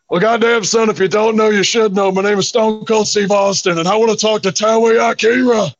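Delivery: a hint of a gruff Stone Cold on the phone; Steve Austin impression. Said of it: "I did my Steve Austin impression".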